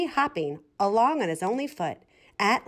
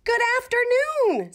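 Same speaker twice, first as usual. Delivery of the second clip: much higher, louder proud chick; chicken greeting; 4 dB louder than usual. A narrator's reading. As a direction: chirp it grandly, pitched way up. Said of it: "said Half Chicken".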